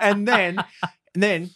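high laughing narration; laughing through words. Real, not acted. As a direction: still giggling.

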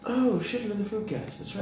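high, thrilled cry; excited. Acted. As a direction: gush over the topic. Mock excitement at topic.

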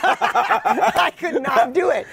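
slow, laughing through the words; real laugh breaking into the line. Real, not acted. Laughing at own failure.

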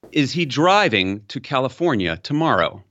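"driving" leaned on, rest even; is he DRIVING to California tomorrow; stress on driving. Shown as a demonstration. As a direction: hit the word "driving" hardest. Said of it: "I've said that sentence four times".

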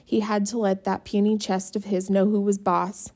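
plain reading voice

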